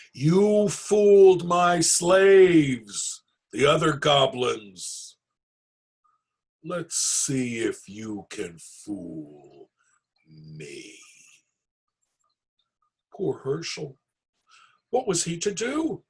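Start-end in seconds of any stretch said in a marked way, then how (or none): 0.1-11.0 taunting goblin king. Acted. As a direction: boom the taunt, then drop to a menacing hush.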